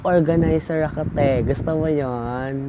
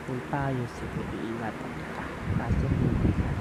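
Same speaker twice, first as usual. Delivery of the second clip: much softer, tender mock line; hushed aside; 4 semitones lower, 7 dB quieter than usual. Vlogger telling her ex he belongs to someone else, softly. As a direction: murmur it like a bittersweet confession.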